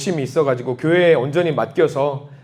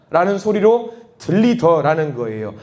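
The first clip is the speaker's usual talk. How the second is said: ordinary delivery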